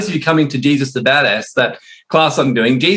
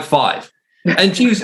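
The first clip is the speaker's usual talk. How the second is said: ordinary talk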